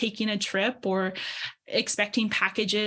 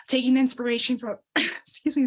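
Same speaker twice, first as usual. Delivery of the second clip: choking on a drink; 4 semitones higher than usual. Real, not acted. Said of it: "Choking a little bit on, on something".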